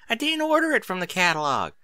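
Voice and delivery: put-on high child's protest; indignant little sister; a hint of a protesting child; protesting filly. A narrator's reading. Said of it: "Applebloom protested".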